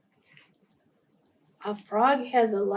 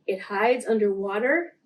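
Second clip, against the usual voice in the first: ordinary delivery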